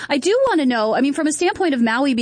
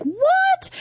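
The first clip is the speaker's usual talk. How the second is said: mock surprise. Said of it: "but I'm like".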